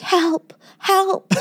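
high little mock cries for help; toddler pleading. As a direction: imitate a small child calling for help. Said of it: "And he goes".

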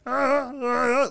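high yelping Tusken Raider ah ah; Tusken Raider cry. Retold or imitated. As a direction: bark it out like a desert raider. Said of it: "he's like".

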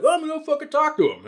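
mock tough dad; stepdad's voice. Stepdad deciding to confront stranger, mock voice. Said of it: "he's like".

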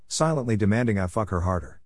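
ordinary delivery, nothing marked

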